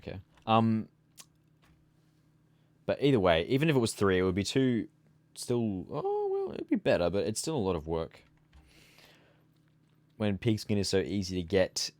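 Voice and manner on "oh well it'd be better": ordinary talk, nothing marked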